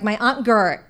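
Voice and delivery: ordinary talk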